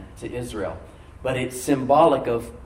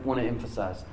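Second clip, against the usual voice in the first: normal talking voice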